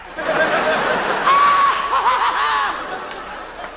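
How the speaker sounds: high, long crazed laugh; hammed-up unhinged cackle; manic mock laugh. Reformed alcoholic cracking up.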